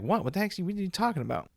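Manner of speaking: high-pitched what the heck, imitating himself; incredulous retold outburst; retold disbelief. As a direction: replay your own shocked protest, up high. Retold shock at friend's claim.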